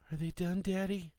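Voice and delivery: put-on high-pitched little-girl question; child's voice. A narrator's reading. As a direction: ask Daddy as a small child. Young daughter asks her father.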